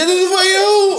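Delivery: hype call. Real, not acted. Hyping up a city shout-out.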